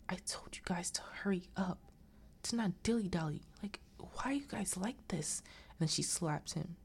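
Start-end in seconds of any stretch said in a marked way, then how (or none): 0.1-5.4 hushed, exasperated whisper; whispered scolding. Retold or imitated. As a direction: imitate an angry telling-off while hiding. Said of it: "you can hear little whispers still".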